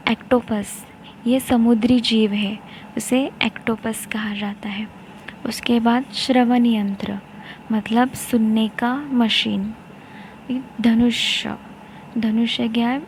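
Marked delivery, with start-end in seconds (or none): none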